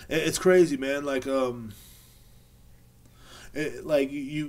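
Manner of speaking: ordinary delivery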